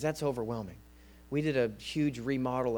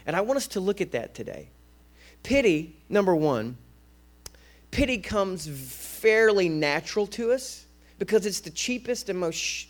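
ordinary delivery

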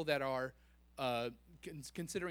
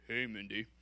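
low devil voice; enemy's voice; 6 semitones lower than usual. Acted. Devil calling Mindy by name, low tempter voice. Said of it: "it may sound something like this".

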